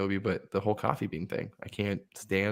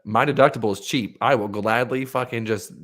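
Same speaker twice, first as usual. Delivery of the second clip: ordinary talk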